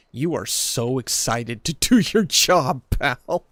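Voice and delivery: loud, drawn-out mock gushing; mock enthusiasm. Streamer teasing an excited game creature, mock enthusiasm.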